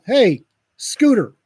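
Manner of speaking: high, loud and punchy teasing; hollered mock advice. Bystander warns the puking teen off the leather seat, hollering. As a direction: call it out to the sick kid.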